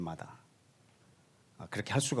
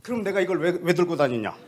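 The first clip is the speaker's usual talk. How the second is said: louder, higher, testy retort; mock annoyed question; 6 semitones higher, 5 dB louder than usual. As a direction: push back, half laughing. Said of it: "이 대화가 이랬어요?". (짜증 섞인 반문)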